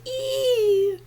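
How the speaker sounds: long high elephant squeal; imitated trumpeting on a held "ee".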